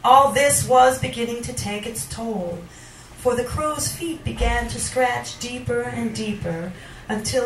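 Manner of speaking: deliberate, theatrical recitation with long pauses; dramatic poetry reading. Acted. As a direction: recite it as a dark monologue. Reads a poem aloud.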